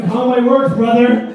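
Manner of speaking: loud greeting with a heavy R on "brother"; exaggerated R ending "brother"; hard-R brother. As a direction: hit the final R of "brother" too hard. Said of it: "Hard R, brother".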